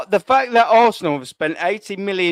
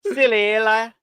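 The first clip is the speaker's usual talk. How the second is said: mock Scouse accent; 2 semitones higher than usual. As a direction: put on a hammed-up Liverpool accent. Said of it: "Just like I'm doing now".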